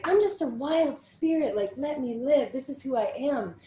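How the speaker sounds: put-on high, loud, airy wild-child voice; carefree wild spirit. Young self shrugging it all off, carefree and loud. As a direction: toss it off like a carefree free spirit. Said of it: "And to me, it was like".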